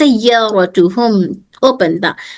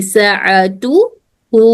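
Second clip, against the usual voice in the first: slow model word; 2 semitones lower, much slower than usual. Shown as a demonstration. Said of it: "ये ताए मफ्तूहा में बदल जाएगी".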